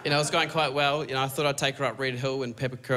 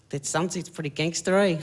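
a hint of the date's voice, pitched higher; slightly higher mock-impressed remark; lightly voiced date; 6 semitones higher than usual.

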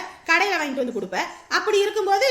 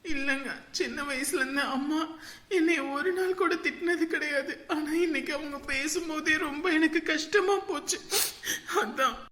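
soft, tearful confession through sobs; tearful, soft crying; 4 dB quieter than usual. Acted. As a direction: hold back tears as you confess. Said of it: "இதுக்கு தான் போய் அழுதுட்டு இருக்கியா?". (அழுதபடி மெல்லிய குரல்)

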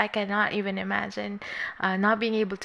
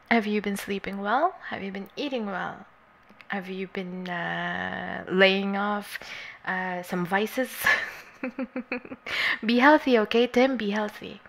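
ordinary delivery

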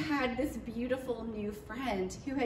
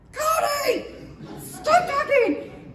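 high, loud, drawn-out laughing shout; laughing yell; 8 dB louder, much slower than usual. Retold or imitated. Stopping Cody before the family hears, high laughing shout. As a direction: pounce on him with a half-laughing alarm. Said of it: "and I'm like".

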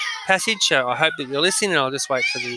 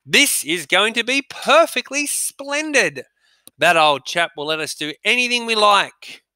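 put-on bright, higher, slower boy's voice; voicing Peter; 6 semitones higher than usual. Teacher reading Peter's line, pleased and bright. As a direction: play Peter, pleased with their luck. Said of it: "said Peter".

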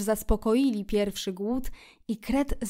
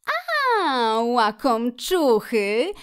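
exclaiming; 2 semitones higher, 5 dB louder, much slower than usual. A narrator's reading. Otter catches the friends at their food, exclaiming. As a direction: pounce on them with a playful shout. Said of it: "wykrzyknęła".

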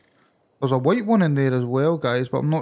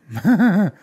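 louder, higher burst of laughter; 5 semitones higher, 6 dB louder than usual. Real, not acted. Gamer about to touch the dam, laughing. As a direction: laugh out loud in quick bursts.

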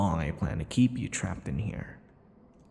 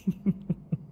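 soft teasing chuckle; 12 semitones higher, 3 dB quieter than usual. Acted. Vampire lover mocks his captive.

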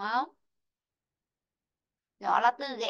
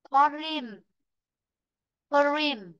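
"Foreign" with FOR stressed; teacher's model "Foreign", high start and falling end; stress on first syllable; 3 semitones higher, much slower than usual. Shown as a demonstration. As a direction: lean on "For" in "Foreign". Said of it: "Vị trí thứ nhất".

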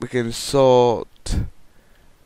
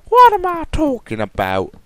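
much higher and louder, silly squeak; squeaky mock self-question; 8 dB louder than usual. Vlogger mocking his own line in a squeak. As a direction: squeak the question back at yourself.

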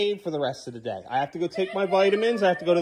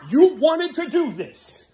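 slow, stern, raised voice; slow angry shout; 8 semitones higher, 4 dB louder, much slower than usual. Real, not acted. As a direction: lay each word down hard at him. Father scolding son over doors.

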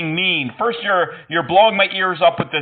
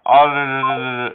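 drawn-out tongue trill; rolled R attempt; 5 semitones lower than usual. Shown as a demonstration. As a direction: practise rolling a long R. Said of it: "Can you help me roll the R's?".

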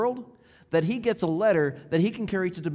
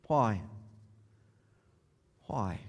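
hushed why; 8 semitones lower, 7 dB quieter than usual. Real, not acted. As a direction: lower and softer each time. Sermon's closing hushed repeated why.

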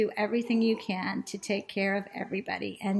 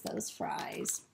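ordinary delivery

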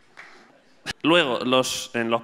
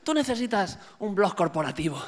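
softer coach voice, lightly put on; mock business coach; 3 semitones higher, 6 dB quieter than usual. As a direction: imitate a coach giving soft-spoken advice. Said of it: "un coach a lo mejor les ha dicho". (imitación suave de un coach)

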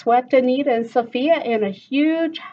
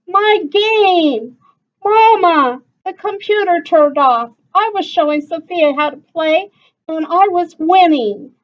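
high, loud whiny protest; upset complaint; 9 semitones higher, 9 dB louder than usual. Acted. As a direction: stomp and complain to mom. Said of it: "stomped her feet".

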